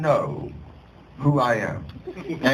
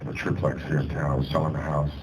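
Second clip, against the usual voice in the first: much lower, deadpan voice; the actor friend's put-on low voice, confessing he lost nothing; Christopher Lloyd voice; 11 semitones lower, 3 dB quieter, faster than usual. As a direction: drop low and dry, confiding the truth.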